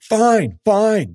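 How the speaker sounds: high-pitched, imitated tearful whine; mock crying. Wife caught stealing, whining fine through mock tears. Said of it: "started crying".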